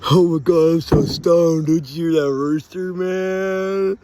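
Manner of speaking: loud, drawn-out stoned amazement; amazed stoned outburst. Real, not acted. Stoned man marvelling at a rooster crow, loud and amazed. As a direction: blurt it out loudly, stretching the end.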